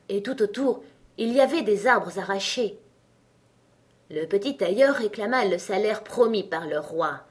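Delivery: plain reading voice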